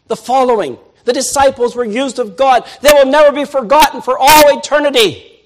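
loud, high fervent preaching; full-force sermon climax. Acted. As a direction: drive it home from the pulpit.

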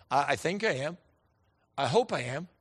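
soft, low, hesitant reply; mildly acted doubt, voice dropped; unsure answer. Acts out unsure answers to question.

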